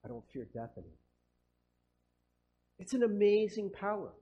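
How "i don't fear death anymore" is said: low, soft, serene acceptance; softly resigned to death, unafraid; calm unafraid. Acted. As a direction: say it with quiet peace, no fear. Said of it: "But they're like".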